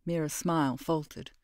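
ordinary delivery, nothing marked

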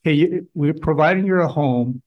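normal talking voice

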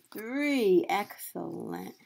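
ordinary talk, nothing marked

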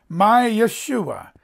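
"Yeshua" drawn out slowly as ye-SHU-a; stress on second syllable. Shown as a demonstration. Preacher teaching the Hebrew for "my salvation", slow second-syllable stress on "Yeshua". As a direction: stretch "My Yeshua", leaning on the SHU. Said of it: "emphasize the second syllable".

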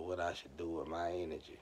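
ordinary talk

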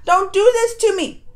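high, loud mock panic, begging the show; panicked pleading. Viewer to the show about missing subtitles, mock panicked plea. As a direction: cry out half-joking, please don't do this. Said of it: "I'm like".

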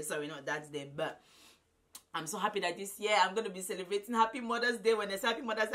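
ordinary delivery

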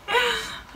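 high, brief near-crying laugh; shaken laugh. Real, not acted. Player recovering from a scare, high shaken laugh. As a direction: let out a high leftover giggle.

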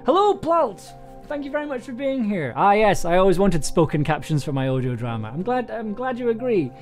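ordinary talk

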